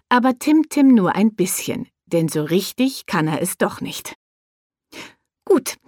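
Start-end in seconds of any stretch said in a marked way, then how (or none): 5.4-5.7 beaming. Acted. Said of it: "sagt Bea strahlend".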